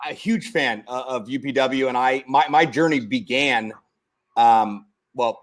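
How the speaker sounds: usual speaking voice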